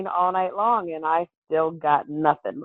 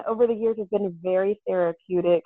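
ordinary talk

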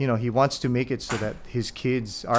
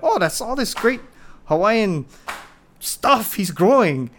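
mild gushing; 9 semitones higher, 4 dB louder than usual. Retold or imitated. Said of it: "I went".

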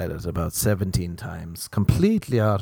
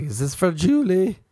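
raised pitch, questioning; higher-voiced mimicked question; lightly voiced girl; 9 semitones higher than usual. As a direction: lightly imitate a woman asking a stranger. Acted quote of the girl.

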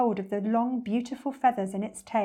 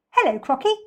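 higher, louder greeting; lively storybook monkey; cheeky monkey; 5 semitones higher, 7 dB louder than usual. Acted. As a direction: call it out perkily like a monkey.

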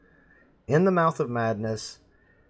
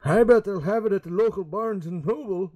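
mock naive dad; 8 semitones higher, 3 dB louder than usual. Self-quote, old-fashioned dad.

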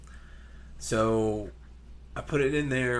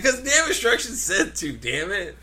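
exasperated; 10 semitones higher, 5 dB louder than usual. Retold or imitated. Retold exasperated reply to repairman.

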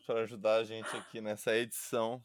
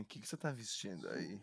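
soft, low sultry question; phone sex voice; 5 semitones lower, 12 dB quieter than usual. Retold or imitated. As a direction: purr it into the phone. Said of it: "que você ligava de sexo no telefone". (voz de telessexo)

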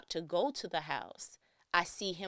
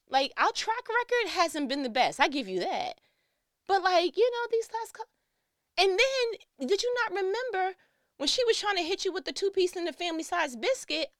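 high, loud, fast pleading rant; mock pleading to brother; 12 semitones higher, 7 dB louder than usual. Sister pleading with her estranged brother, high and loud. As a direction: plead your case to a brother who's shutting you out.

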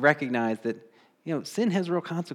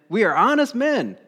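high, loud insistent protest; mock earnest protest; pleading brothers; 8 semitones higher, 5 dB louder than usual. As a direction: insist on innocence, overdoing it.

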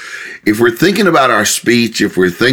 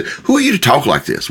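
higher-pitched incredulous question; pointed challenge; 6 semitones higher than usual. Acted. God's real question to presumptuous talkers, pointedly challenging. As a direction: press the reproof, voice lifted. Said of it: "that's the real".

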